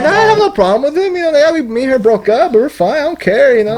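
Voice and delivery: high, airy mock innocence; mock innocent denial. To the principal, playing innocent in a high mock voice. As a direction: play it off as totally fine. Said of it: "I was like".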